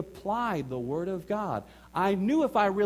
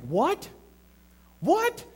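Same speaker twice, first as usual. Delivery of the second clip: much higher, incredulous squeak; shocked what; 13 semitones higher, 5 dB louder than usual. Retold or imitated. Wife hearing the apartment offer, shocked and disbelieving. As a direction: react like you can't believe it.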